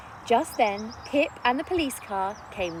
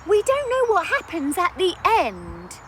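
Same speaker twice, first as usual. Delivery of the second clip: put-on high, loud little boy's voice; pleading child; 9 semitones higher, 8 dB louder than usual. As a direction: plead like a kid.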